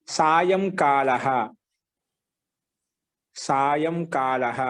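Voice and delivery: very slow సాయంకాలః with long held vowels; slow dictation. Shown as a demonstration. Teacher dictating a noun for students to write, slowly. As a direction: stretch సాయంకాలః out syllable by syllable.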